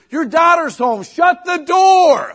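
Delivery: high, loud, hammed-up shout from the wife; mock wife shout. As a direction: yell the order slowly from another room. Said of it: "She goes".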